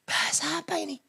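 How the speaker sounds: hushed, high-pitched amazement; soft astonished question; baffled scientist. Acted. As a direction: whisper it in stunned disbelief. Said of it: "Saintis bilang".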